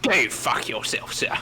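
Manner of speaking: posh British. Retold or imitated. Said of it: "which one's the posh British accent".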